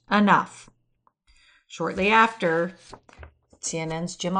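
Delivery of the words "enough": a short, louder mock snap; snapped. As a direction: clip it off like a mild retort. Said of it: "and snapped".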